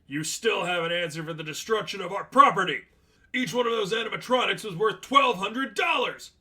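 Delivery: high, loud demanding yell; angry shout. Acted. Manager demanding payment.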